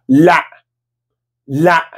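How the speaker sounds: "Lá" cut off short by a glottal stop. Shown as a demonstration. Teacher has students repeat the Arabic no, sound cut off. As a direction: say "Lá" and chop the vowel in the throat. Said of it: "uma parada vocálica pra cortar o som".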